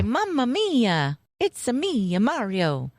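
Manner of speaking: put-on slow Italian Mario accent, lightly done. Quoting the movie's opening line in light Mario Italian accent. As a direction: drawl mamma mia the Mario way. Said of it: "they do say".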